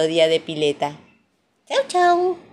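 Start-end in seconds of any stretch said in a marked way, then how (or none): 1.7-2.4 high, loud little sing-out; squeaky playful farewell; cheery goodbye. A narrator's reading. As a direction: wave off small children with a bright bye. Storyteller's cheery sign-off to children.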